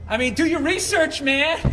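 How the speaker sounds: high, pouting, indignant whine; whiny high voice. Retold or imitated. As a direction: sulk it out, high and petulant. Bitter bassist interview, acted quote.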